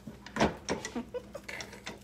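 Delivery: short soundless-edged chuckle; breathy little laugh. Real, not acted. Cook amused by her own porridge advice, laughing.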